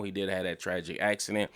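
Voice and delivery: ordinary delivery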